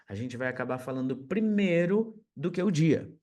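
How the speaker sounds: usual speaking voice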